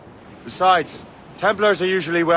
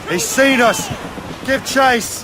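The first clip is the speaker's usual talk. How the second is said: urgent order; 7 semitones higher, slower than usual. Acted. Captain urging chase in battle.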